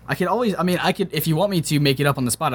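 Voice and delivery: ordinary talk, nothing marked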